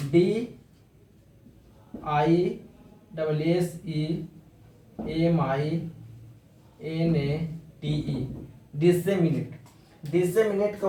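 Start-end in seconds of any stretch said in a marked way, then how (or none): 0.1-8.4 each letter of डीआईडबलएसईएमआईएनएटीई named slowly, one by one; spelling out slowly. Shown as a demonstration. Teacher spelling disseminate for students, slowly. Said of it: "third word है disseminate".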